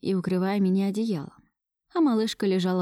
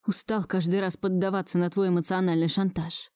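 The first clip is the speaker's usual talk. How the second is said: ordinary delivery, nothing marked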